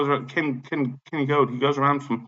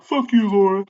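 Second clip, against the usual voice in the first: high-pitched, playful mock call-out; mock taunt; 8 semitones higher, 4 dB louder than usual. Imitating his own jeer at the manager, high mock taunt. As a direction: taunt her in a raised, high voice. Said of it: "Not real loud, just like".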